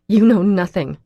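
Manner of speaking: loud, cutting retort; furious reply to a friend's lecture; angry retort. A narrator's reading. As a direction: throw it back hard. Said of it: "I retort angrily".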